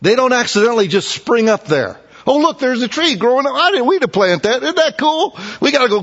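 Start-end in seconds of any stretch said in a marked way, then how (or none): 2.3-6.0 mock careless bystander. Mocking people who find trees.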